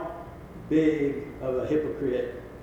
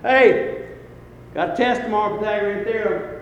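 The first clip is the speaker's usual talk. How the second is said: raised-pitch teacher call-out; mild holler; 6 semitones higher, 4 dB louder than usual. Retold or imitated. Teacher to students walking out at the bell, mild holler. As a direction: call after departing students, only mildly raised. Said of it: "and I holler".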